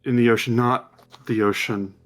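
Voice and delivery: slow, hushed scene setting; measured storyteller voice; game master's vision narration. Acted. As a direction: draw them into the vision.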